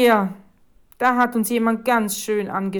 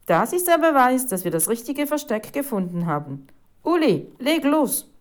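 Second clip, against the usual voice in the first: loud, raised, eager voice; excited urging; 6 semitones higher, 6 dB louder than usual. A narrator's reading. Reporter sending photographer to work on the proof, excited. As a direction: spur the photographer into action.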